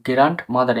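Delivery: গ্র্যান্ডমাদার as grant-madar, stop audible; mistaken pronunciation. Shown as a demonstration. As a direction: put a hard D or T before the M in গ্র্যান্ডমাদার. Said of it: "কেউ কেউ উচ্চারণ করে". (গ্র্যান্ডমাদার-এ ড স্পষ্ট উচ্চারণ করে)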